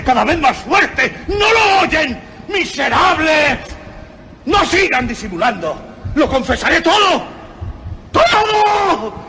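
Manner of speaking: high, desperate screams of confession; frantic screaming. Acted. Murderer shouting at the police officers, frantic screaming. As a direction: scream it out like a man coming apart. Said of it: "Era necesario gritar o morir".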